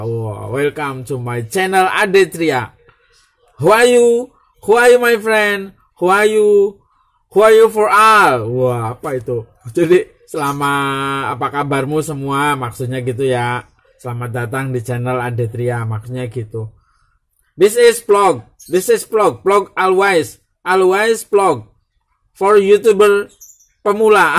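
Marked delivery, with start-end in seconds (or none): 3.6-8.5 high, loud mock questions; hammed-up English. Vlogger hamming up broken English questions to viewers. 17.5-24.4 high, loud announcer-style motto; hammed-up slogan. Vlogger announcing his motto loudly to beginner YouTubers.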